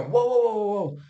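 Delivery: high, loud startled cries of whoa. Retold or imitated. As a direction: jump up alarmed, calling whoa. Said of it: "you go".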